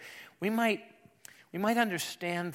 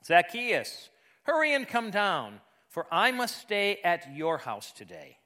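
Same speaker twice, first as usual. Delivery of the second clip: raised, deliberate pulpit reading; Scripture reading; 4 semitones higher than usual. A narrator's reading. Preacher reading the Zacchaeus story to the congregation, emphatic Scripture reading. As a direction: read the Bible story aloud, emphatic and measured.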